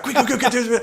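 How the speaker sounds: very high, hurried imitation; mock urgent urging. Client smoking mid-tattoo urging the tattooist, mock urgent high voice. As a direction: egg him on, pitched right up. Retold urging during smoke in shop.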